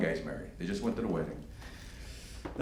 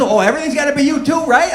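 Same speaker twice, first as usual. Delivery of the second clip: higher, louder, faster wife's snap; mock angry wife; 9 dB louder, faster than usual. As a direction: snap back like the offended wife.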